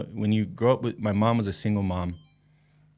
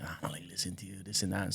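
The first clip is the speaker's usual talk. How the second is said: put-on dismissive teen; 9 dB quieter than usual. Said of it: "you're like".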